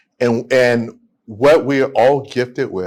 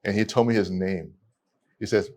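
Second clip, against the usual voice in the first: usual speaking voice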